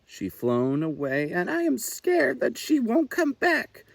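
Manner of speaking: high, pleading little owl wanting her mother; a lost chick close to tears; tearful owlet. A narrator's reading. Little Owl telling the fawn she's scared, high and pleading. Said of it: "cried Little Owl".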